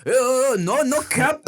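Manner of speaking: put-on high, loud Japanese accent slang. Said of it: "but with a Japanese accent".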